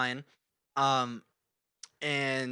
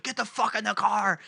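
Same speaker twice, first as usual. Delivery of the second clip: high, urgent mock yell; retold cop order; 6 semitones higher than usual. Imitating cop at opened doors, high barked order. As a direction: command like an irate officer. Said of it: "the cop was like".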